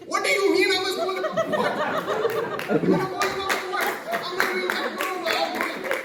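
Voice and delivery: put-on very high, very loud Pakistani-accented outrage; mock indignant yelling, Pakistani accent; caught Pakistani accent. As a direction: argue back in a hammed-up Pakistani accent. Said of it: "that accent came out in me too".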